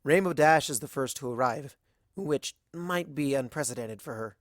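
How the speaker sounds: plain reading voice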